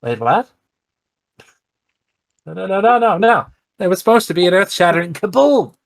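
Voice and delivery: high nasal-free cartoon alien, loud and fast; squeaky cartoon Martian outburst; Marvin the Martian. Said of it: "in the Marvin the Martian voice".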